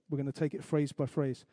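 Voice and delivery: ordinary delivery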